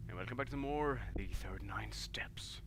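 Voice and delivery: hushed unvoiced whisper. Real, not acted. Gamer welcoming viewers back, whispering. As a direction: breathe the welcome like a secret.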